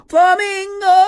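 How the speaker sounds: gasp, then a high, loud, drawn-out cry; excited exclamation. Real, not acted. Streamer finds a favorite bird card, thrilled shout.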